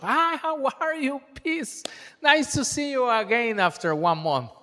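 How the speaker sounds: high, breezy fake-friendly hello; mock cheery greeting. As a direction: play a colleague all smiles and no follow-up. Mock greeting at next workshop.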